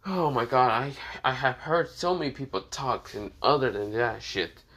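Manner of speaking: usual speaking voice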